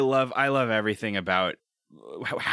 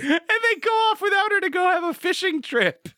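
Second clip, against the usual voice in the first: high squeaky voice; 4 dB louder than usual. Retold or imitated. Mocking cartoon plot, squeaky.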